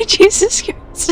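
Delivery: slow, breathy gasp out of laughter; breathless laughing gasp. Real, not acted. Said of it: "I can't stop laughing".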